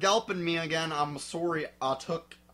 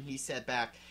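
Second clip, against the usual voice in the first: ordinary delivery, nothing marked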